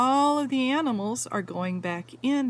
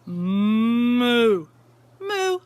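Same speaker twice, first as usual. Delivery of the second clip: loud cow moo; 8 dB louder than usual. Acted. Reading to a child, mooing loudly like the cow.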